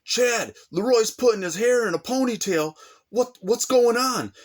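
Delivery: high, alarmed woman's voice; cousin's ex-wife warns Chad, high and alarmed. Retold or imitated. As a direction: mimic a worried cousin's wife calling out. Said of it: "she's like".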